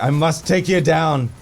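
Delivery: mock-heroic battle vow; dramatic resolve; determined. Determined line to boss.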